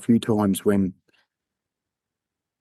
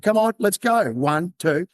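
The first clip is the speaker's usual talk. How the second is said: higher, insistent count; urging; 8 semitones higher, slower than usual. Retold or imitated. Cop grabs the friend again at the cliff, urging. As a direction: pull him along with the count.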